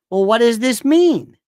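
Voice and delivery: loud, drawn-out demand for meaning; exasperated question. Retold or imitated. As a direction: push the question out slowly and loudly.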